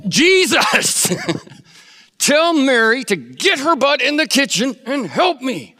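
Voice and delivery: high, loud whiny appeal; exasperated complaint. Retold or imitated. Preacher voices Martha appealing to Jesus, high loud complaint. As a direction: demand Jesus take your side, high and loud.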